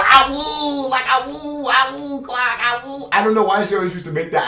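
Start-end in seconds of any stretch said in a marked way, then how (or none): 0.0-0.9 high yelping ah-woo call; howling like a wolf pup. Retold or imitated. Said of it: "this one thing where she would just go". 1.1-3.1 high yelping ah-woo call; howling like a wolf pup. Retold or imitated. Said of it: "this one thing where she would just go".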